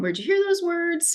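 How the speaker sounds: high, gentle curious question; very calm. Shown as a demonstration. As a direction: ask it lightly, no alarm. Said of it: "so very calm".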